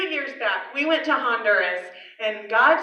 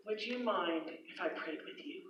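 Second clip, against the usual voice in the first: soft offer; 5 semitones lower, 20 dB quieter than usual. Retold or imitated. Gently offering prayer to stranger.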